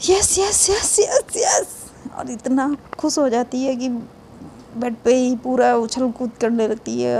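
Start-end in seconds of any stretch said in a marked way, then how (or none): 0.1-1.9 giddy yes. Acted. Continues joyful yes.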